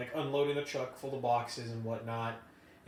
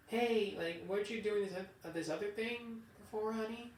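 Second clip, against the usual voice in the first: put-on higher, sing-song talk to a kid; mock a boss calling a grown worker honey; mama boss talking to a child; 7 semitones higher than usual. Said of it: "talk to you like she would talk to her kids".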